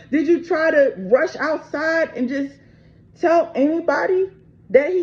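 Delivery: real anger at the wife; real angry outburst. Real, not acted. Raging at the wife's story.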